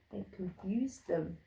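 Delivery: ordinary delivery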